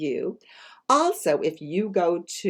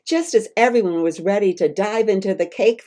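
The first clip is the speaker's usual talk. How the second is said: ordinary delivery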